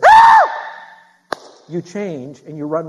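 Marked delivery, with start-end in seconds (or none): 0.0-0.5 sudden high, very loud scream; child's pain scream. Retold or imitated. Preacher imitates a kid with smashed finger, piercing scream. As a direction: shriek like a hurt kid. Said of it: "that same kid goes".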